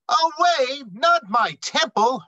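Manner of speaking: mother's high, loud outraged cry; a mother refusing to give up her daughter; protesting mother. Acted. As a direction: fling back the word away in disbelief. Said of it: "her mother proclaimed".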